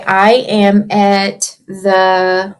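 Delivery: I am at the park said slowly, word by word; slow dictation. Shown as a demonstration. Teacher proposing a sentence, slow dictation. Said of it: "What if we say".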